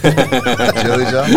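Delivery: laughing. Real, not acted. Incredulous, laughing at phrase.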